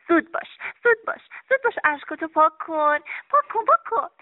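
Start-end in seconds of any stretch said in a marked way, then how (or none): 0.8-1.7 زود باش said higher, bright and quick; eager urging. Acted. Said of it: "خودت باید ببینی". 3.3-4.1 پاک کن repeated higher and bouncy; eager coaxing. Acted. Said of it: "خودت باید ببینی".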